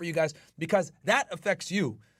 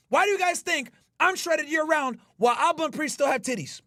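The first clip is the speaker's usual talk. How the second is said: hyped rant; 8 semitones higher than usual. Real, not acted. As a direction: brag like a gym hype man. Ranting trash talk at rivals.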